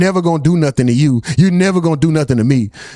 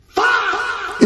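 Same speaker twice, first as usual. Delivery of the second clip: real stunned outburst; shocked outcry; 5 dB quieter than usual. Real, not acted. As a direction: blurt it out floored. Shocked by chat's gossip.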